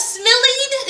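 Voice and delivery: high, puzzled "smillied", said SMILL-eed; wrong reading with long E. Shown as a demonstration. Teacher asking what two E's would do, puzzled high "smillied" as SMILL-eed. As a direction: question "smillied" with an ee sound. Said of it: "what happened if we put two E's".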